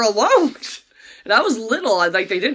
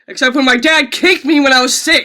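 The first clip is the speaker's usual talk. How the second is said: louder, laughing through the words; real laughter, louder; 5 semitones higher, 9 dB louder than usual. Real, not acted. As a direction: laugh while telling it. Laughing about dad's kick.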